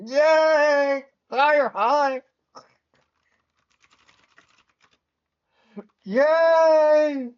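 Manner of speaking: high, loud squeals of joy; excited squeal. Real, not acted. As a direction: squeal with delight.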